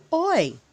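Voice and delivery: "Oi" as a clear diphthong, o gliding to i; oi sound. Shown as a demonstration. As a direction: model the sound "Oi" for young learners. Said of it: "The oi sound".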